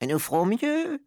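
very high, meek chorus voice; timid witches promising in a squeaky high voice; murmuring assembly. A narrator's reading. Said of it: "murmura l'assistance".

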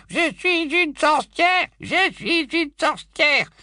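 high, slow mocking squeak; squeaky little voice. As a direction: mimic a pleading little witch. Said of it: "en imitant une petite voix couinante".

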